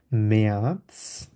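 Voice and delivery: মেয়াদস drawn out, umlaut vowel and ts ending; teacher models মেয়াদস slowly; slow German demo. Shown as a demonstration. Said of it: "আয়ের উপর umlaut আছে". (ধীরে মেয়াদস উচ্চারণ)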